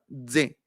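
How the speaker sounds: "D" pushed out as "dze"; demonstrated buzzy dze; forced D. Shown as a demonstration. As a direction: press the D until a Z escapes. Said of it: "le aplicamos fuerza".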